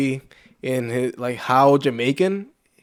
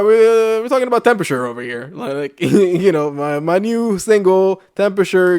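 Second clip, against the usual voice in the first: mock [name]; 5 semitones higher, 3 dB louder than usual. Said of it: "he just went full [name]".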